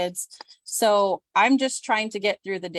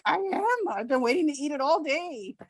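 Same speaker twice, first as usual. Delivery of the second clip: much higher, giddy and eager; high eager gush; 7 semitones higher than usual. Retold or imitated. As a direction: gush like it's a treat. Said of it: "I'm like".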